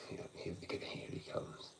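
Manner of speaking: whispered, dreading; hushed under the breath as he nears; quiet sarcastic mutter about a neighbour; muttered aside. Retold or imitated. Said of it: "And I'm just like".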